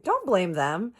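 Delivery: high, loud "Don't blame them"; retold indignant objection. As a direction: cut him off in acted indignation. Retold quarrel, first take.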